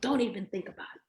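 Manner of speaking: brisk mock threat at full voice; quick voiced warning. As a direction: rattle the warning off aloud. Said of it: "like that".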